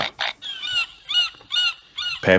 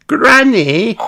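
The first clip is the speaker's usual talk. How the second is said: Peppa voice; 6 dB louder than usual. A narrator's reading. Peppa calls Granny.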